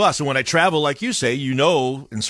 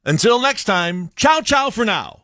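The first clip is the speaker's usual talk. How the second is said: flamboyant critic's sign-off; 8 semitones higher, slower than usual. Retold or imitated. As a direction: draw out a flamboyant TV sign-off, pitched way up. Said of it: "a bit flamboyant".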